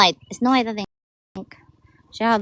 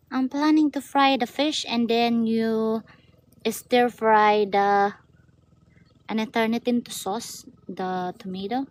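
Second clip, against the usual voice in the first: normal talking voice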